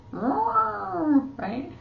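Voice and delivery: squeaky, high, rising right; playful high question. Real, not acted. Sign teacher teasing students after the elephant sign, squeaky.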